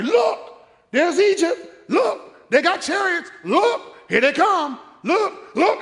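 high, loud rising alarm; fearful naysayers. Retold or imitated. Doubters pointing at chariots, high and loud. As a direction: point out every danger. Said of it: "They wanna tell me".

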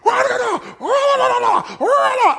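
high, loud hammed-up rah rah; mock rattled yelling. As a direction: play at losing your temper. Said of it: "all rattled and go".

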